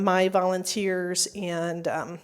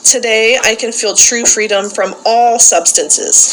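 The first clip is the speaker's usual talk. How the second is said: loud call-and-response recitation, each line declared for the group to repeat; loud group-leader recitation; leading a creed; 13 dB louder than usual. Acted. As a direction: proclaim it to the room like a rallying creed. Leads daily creed recitation.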